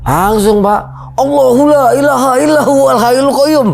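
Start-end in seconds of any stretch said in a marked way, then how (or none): 1.2-3.7 high, comic panicked recitation; baca ayat kursi panik. Retold or imitated. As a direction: imitate a frightened man blurting out a prayer. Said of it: "kok dia baca ayat kursi". (tiruan baca doa panik, nada tinggi)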